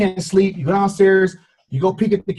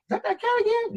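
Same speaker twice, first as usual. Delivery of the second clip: surprised; 7 dB quieter than usual. Retold or imitated. Surprised cat is back.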